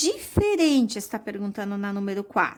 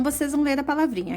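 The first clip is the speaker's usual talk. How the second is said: normal talking voice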